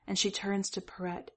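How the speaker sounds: plain reading voice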